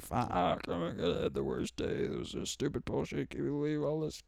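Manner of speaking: breathless, half-whispered complaining after a bad day; whiny stressed venting. Coming home stressed, venting to a loved one, in a breathy whine. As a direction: gripe under your breath, drained and exasperated.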